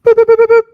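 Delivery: high, loud beeping buzzer noise; shouted boop buzzer; mock buzzer. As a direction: imitate a game-show timer buzzer. Buzzer sound for time up.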